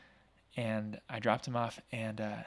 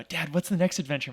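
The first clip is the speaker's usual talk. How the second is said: put-on higher, louder eager kid voice; kids asking; 6 semitones higher, 6 dB louder than usual. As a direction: play the excited kids begging for more. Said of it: "and they go".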